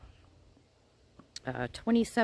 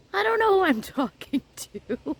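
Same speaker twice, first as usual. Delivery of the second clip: laughing; 11 semitones higher, 4 dB louder than usual. Retold or imitated. Flustered reply to the receptionist.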